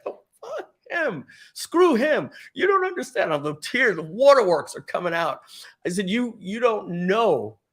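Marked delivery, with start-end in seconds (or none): 0.0-3.3 high, sobbing protest; tearful outburst. Retold or imitated. Tearful rant at coworkers begging him to calm down. 6.1-7.6 retold hysterical crying. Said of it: "And I'm crying hysterically".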